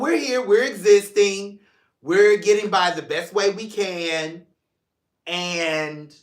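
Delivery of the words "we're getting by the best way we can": ordinary talk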